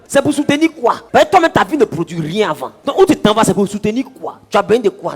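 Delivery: loud, high, mocking questions; shouting rhetorical questions at the idle; scolding challenge. Preacher challenges idle people.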